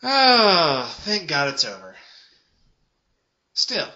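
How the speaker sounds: usual speaking voice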